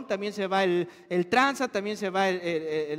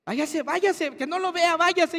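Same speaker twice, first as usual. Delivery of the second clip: teacher shooing parents; 7 semitones higher than usual. Retold or imitated. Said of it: "qué te decían los maestros".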